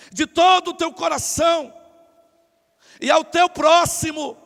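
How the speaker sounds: loud, high declamation; memorised recitation. Acted. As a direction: recite it loudly like a learned speech. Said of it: "tava decorado". (discurso decorado, declamado alto)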